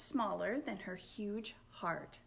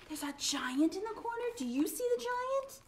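high, eager questions; thrilled listener voice; excited questioning; 13 semitones higher, faster than usual. Acted. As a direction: pester excitedly about the giant.

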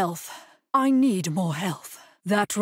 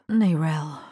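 whisper; 2 semitones lower than usual. Acted. Calling a companion quietly.

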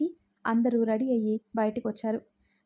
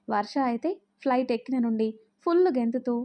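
ordinary delivery

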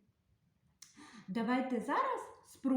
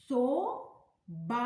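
slow demo word; 4 dB louder, slower than usual. Shown as a demonstration. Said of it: "Промовляємо".

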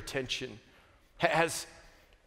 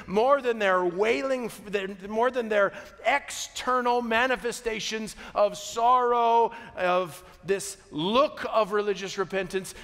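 loud, rising preacher's build on each "more than"; preaching at full force; 5 semitones higher, 5 dB louder than usual. Acted. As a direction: drive it home louder and louder. Preaching climax.

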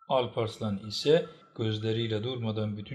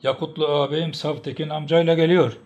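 excited call, building louder and higher; shouting; 4 semitones higher, 8 dB louder than usual. A narrator's reading. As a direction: call out urgent news. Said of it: "diye bağırdı".

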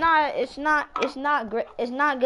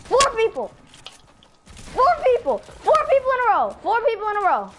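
high, loud repeated crowing; excited shouting; 11 semitones higher, 7 dB louder than usual. Real, not acted. Gamer celebrating four kills in a row, excited shouting. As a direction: shout the streak high and triumphant.

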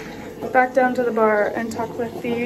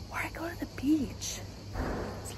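whisper; 5 semitones higher, 7 dB quieter than usual. Real, not acted. Whispering aside on the deck.

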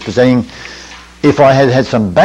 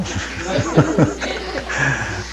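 quiet breathy laugh; 10 dB quieter than usual. Real, not acted.

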